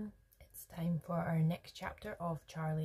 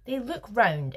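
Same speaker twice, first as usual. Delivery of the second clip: firm, raised-pitch insistence; insistent; 5 semitones higher, 6 dB louder than usual. A narrator's reading. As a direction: state it louder, brooking no doubt. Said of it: "insisted Mister Wonka".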